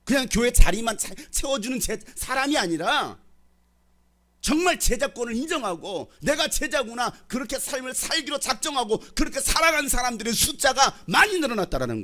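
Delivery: loud, high, driving sermon delivery; fervent preaching. Acted. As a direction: press the challenge on the congregation. Preaching climax. (힘있게 외치는 설교조)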